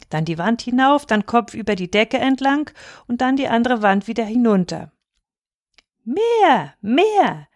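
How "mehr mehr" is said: high, drawn-out cry for more; childish demanding cry. A narrator's reading. Little Häwelmann wants to roll on, demanding cry. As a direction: cry out high, like an insistent small boy. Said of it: "schrie Häwelmann".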